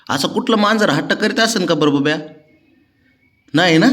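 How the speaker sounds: coaxing pet. Real, not acted. Owner coaxing his sulking cat Babya before work, sing-song coaxing. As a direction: sweet-talk the cat so it lets you leave.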